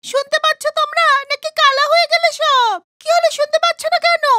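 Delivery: very high, frantic woman calling for help; a captive woman begging the villagers to hear her; tied-up woman pleading. Acted. (গাছে বাঁধা মহিলা গ্রামবাসীদের কাছে উঁচু গলায় সাহায্য চাইছে)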